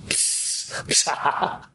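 soft unvoiced psst, psst; hushing psst. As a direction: shush him secretly. Cleaning lady hushing him.

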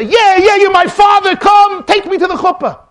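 loud, high, excited cry; eager acceptance. Acted. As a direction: blurt it out with mock eagerness. Adopted boy eagerly accepts the father.

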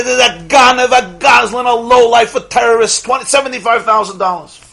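loud, high accusations to the whole hall; public denouncing. Retold or imitated. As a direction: shout it from a chair so everyone hears. Man on chair denounces him publicly.

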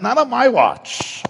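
high, slow, sneering refusal; God taunting the man who wants rest, high and slow; mocking God voice. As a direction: tower over him with a slow, high taunt. God refusing Yaakov's wish.

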